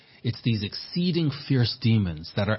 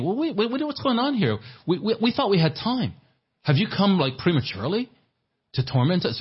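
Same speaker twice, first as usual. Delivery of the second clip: much higher, flustered demon voice; panicked demons; 10 semitones higher than usual. Acted. Preacher voicing demons surprised Christ came early, high flustered panic. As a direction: squeal it like startled demons caught off guard. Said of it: "these demons are saying".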